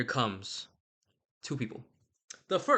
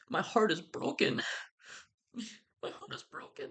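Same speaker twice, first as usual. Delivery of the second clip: mock-heartbroken, high faint whimper; Romeo; 9 semitones higher, 4 dB quieter than usual. Emo lover wailing over his broken heart, hammed-up sorrow. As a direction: overact the heartbreak, thinning into a tiny high voice with a laugh. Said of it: "I'm so emotional right now".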